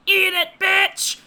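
put-on high, loud, slow German-accented scream; German accent shout. Retelling the film's doctor to cohost, shouting in a German accent. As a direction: bark it like a mad German doctor. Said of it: "in, like, a German accent".

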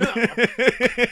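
laugh. Real, not acted. Laughs at his own joke.

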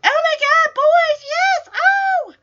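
very high, loud squeal of excitement; imitated giddy shrieking; mock boy-crazy. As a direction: squeal like a hammed-up swooning schoolgirl. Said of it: "boy crazy".

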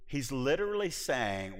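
ordinary delivery

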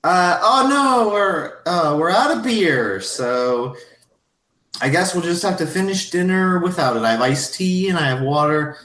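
high, fluttery apologetic voice; flustered hostess. Acted. Mrs Garrison telling guests the beer is gone, high and flustered. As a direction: fuss about the missing beer. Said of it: "she's like".

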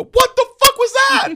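very high, loud, slow shriek; mock outraged heckler. Speaking for the audience, a high mock shriek. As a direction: shriek it like a baffled audience member. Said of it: "I'm like, I'm the".

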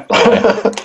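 high, playful accusation; mock-accuse him with a grin; teasing. Real, not acted. Teasing the hungover co-host.

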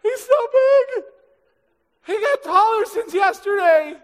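high, loud scared cry; panicked soldier. Retold or imitated. Israelite soldier panicking at the sight of Goliath. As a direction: shriek in hammed-up fright.

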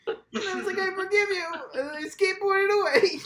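very high cartoon voice; squeaky mock caller. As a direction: squeak it like a giddy teen. Mocking caller voice.

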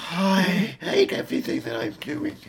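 squeaky high, strained in-breath voice; speaking while inhaling. Shown as a demonstration. Co-host getting lightheaded from the demonstration, talking while inhaling. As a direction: keep talking on indrawn breath, struggling. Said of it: "Really hard to keep speaking like this".

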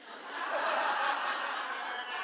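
breathless laughing burst; relieved laugh. Real, not acted. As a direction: laugh out the tension.

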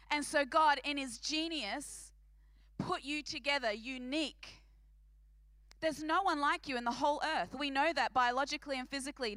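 normal talking voice

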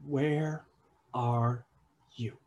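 soft, slow, searching question; a father quietly seeking his hidden children; gentle call. Acted. God softly asking the hiding pair, slow and gentle.

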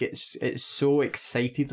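ordinary delivery

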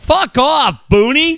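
mock yell. Mock yelling at friend.

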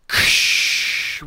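loud imitated hiss of escaping steam; explosive "ksshhh" noise; steam whoosh. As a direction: make a dry-ice burst sound. Imitating box opening with steam.

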